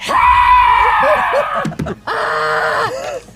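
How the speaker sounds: very high, loud cackling shout; maniacal redcap. Acted. Redcap to the fairies, high cackling shout. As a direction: laugh through the threat madly. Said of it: "redcap cackles".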